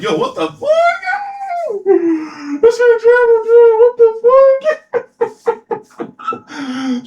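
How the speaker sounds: very high, squealed disbelief; loud falsetto outburst of comic alarm; mock freak-out. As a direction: squeal it out, half laughing, in hammed-up shock. Retold shocked reaction to maniacal laughing.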